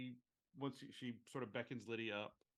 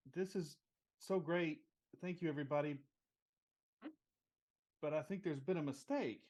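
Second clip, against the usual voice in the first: higher, louder acceptance speech, lightly hammed up; tearfully happy; 5 semitones higher, 5 dB louder than usual. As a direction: play a happy, tearful winner on stage. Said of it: "And she's so happy".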